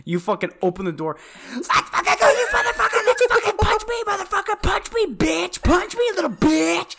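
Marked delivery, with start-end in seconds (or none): 1.5-7.0 shrieked high, loud, cursing through laughter; hammed-up high-pitched yelling at an attacker; mock screaming. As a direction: imitate a friend screaming at the crackhead. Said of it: "start screaming at this fool".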